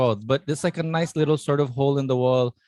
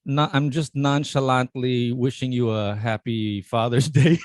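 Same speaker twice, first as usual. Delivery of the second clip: ordinary talk, nothing marked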